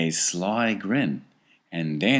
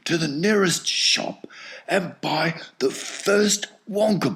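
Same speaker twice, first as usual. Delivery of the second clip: put-on eager hushed old man's voice, higher; urgent old grandfather; 10 semitones higher than usual. A narrator's reading. Grandpa Joe sends Charlie to buy a Wonka bar, urgent and hushed. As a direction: hurry the boy off with a half-whisper.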